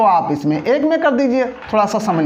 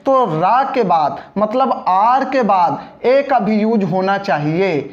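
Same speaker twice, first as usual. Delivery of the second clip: ordinary talk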